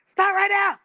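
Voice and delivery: high, loud shout through a window; hollering. Retold or imitated. Kid hollering to sibling at the antenna, high loud shout. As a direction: yell it to the sibling outside. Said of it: "you hollering through the window".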